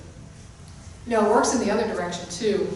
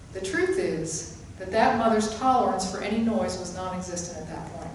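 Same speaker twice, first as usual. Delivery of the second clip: ordinary talk, nothing marked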